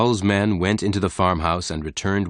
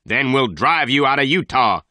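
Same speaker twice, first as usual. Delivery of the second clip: loud, higher threatening shout; shouting threat; 6 semitones higher, 4 dB louder than usual. A narrator's reading. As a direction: push the threat out hard. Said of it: "he shouted".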